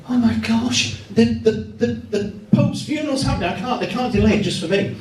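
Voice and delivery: high, fast, breathless inner panic; mock panic at missing the funeral; panicking. Reporter panicking about missing the Pope's funeral, high.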